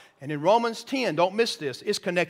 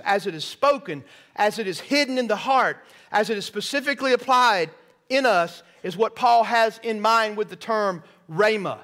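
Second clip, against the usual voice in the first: climbs higher and louder line by line, punching each phrase; rising preacher cadence; 4 semitones higher, 3 dB louder than usual. Acted. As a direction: preach it with mounting force. Preaching builds to full force.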